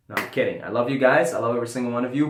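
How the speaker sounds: ordinary delivery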